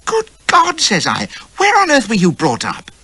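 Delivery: high loud astonished exclaim; shocked. A narrator's reading. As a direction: exclaim in disbelief.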